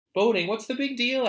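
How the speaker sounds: put-on high, loud dismissive teen; scoffing teenager. Narrator voicing a teenager scoffing at voting, dismissive. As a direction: toss it off like a bored teen. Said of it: "asked a teenager".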